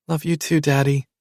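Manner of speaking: put-on a sweet child's goodbye; little girl. A narrator's reading.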